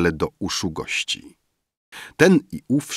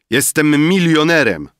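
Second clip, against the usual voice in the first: loud boast; 9 semitones higher, 6 dB louder than usual. A narrator's reading. Drunk Rafael to Emil, loud high boast. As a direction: proclaim it to the whole room.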